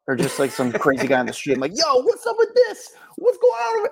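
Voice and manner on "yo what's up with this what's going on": rapper voice. Retold or imitated. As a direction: play a hyped-up guy yelling on the street. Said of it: "I start to talk like a rapper".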